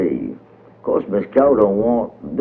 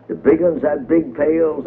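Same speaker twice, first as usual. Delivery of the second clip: plain reading voice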